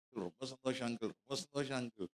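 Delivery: very soft, imitated choked-up gratitude; hushed, as if holding back tears; tearful thanks. Groom thanks uncle for coming to the wedding, softly tearful imitation. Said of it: "டேய் அழுவாத".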